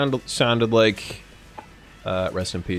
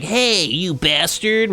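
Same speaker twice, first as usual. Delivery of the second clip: gangster voice demo; 7 semitones higher, much slower than usual. Shown as a demonstration. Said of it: "twenties gangster Yakuza guy voice".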